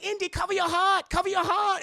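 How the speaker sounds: high, loud urgent call; hammed-up alarmed chorus; frantic warning. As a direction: shout the warning. Everyone warns Indy.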